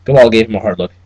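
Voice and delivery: plain reading voice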